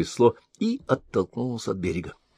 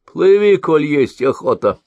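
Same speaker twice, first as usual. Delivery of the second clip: put-on high, louder, grudging old woman; unkind reply; 7 semitones higher, 6 dB louder than usual. A narrator's reading. Old woman answers the sorcerer unkindly, high, sour retort. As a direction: toss it back with ill will. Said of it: "недобро ответила старуха".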